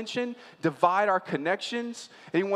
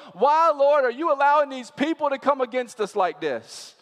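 higher and louder, pleading lament; crying out to God; 6 semitones higher, 5 dB louder than usual. Retold or imitated. Israelites voiced crying out to the Lord, pleading loudly. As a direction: cry out the question to heaven. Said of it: "You ever cried that out".

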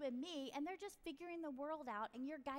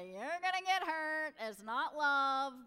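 dismissive parent; 3 semitones higher, 10 dB louder, slower than usual. Retold or imitated. Voicing dismissive parent lecturing teen.

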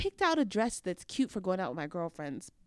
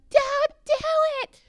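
much higher, louder teasing shout; heckler yell; 11 dB louder than usual. Retold or imitated. Random guest heckling the proposal, loud mock yell. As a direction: heckle from the back.

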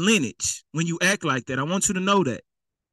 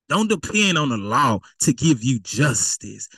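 ordinary talk, nothing marked

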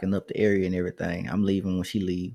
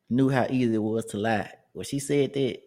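usual speaking voice

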